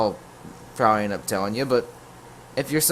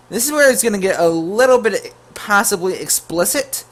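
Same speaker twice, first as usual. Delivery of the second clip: mock warning; 7 semitones higher, 7 dB louder than usual. Real, not acted. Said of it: "younger viewers, turn away now".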